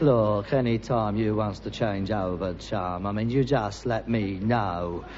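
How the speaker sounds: low, soft, measured dare; low grim challenge. Acted. Son daring his father to swap jobs. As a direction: keep it quiet and heavy.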